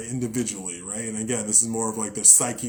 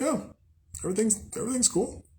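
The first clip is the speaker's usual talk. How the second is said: breezy mock calm; 10 semitones higher, 4 dB quieter, faster than usual. Imagined calm reaction to bystander.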